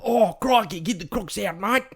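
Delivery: high, loud, hammed-up Aussie; bad Australian accent. Co-host egging on the bad accent, hammed-up Australian. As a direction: put on an exaggerated Australian twang. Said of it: "do a really bad Australian accent".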